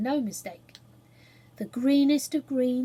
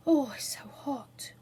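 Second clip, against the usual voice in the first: slow, whispered complaint about the heat; whispered sigh; 2 semitones higher than usual. Acted. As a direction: breathe it out in a whisper. Lamb sighs in the heat.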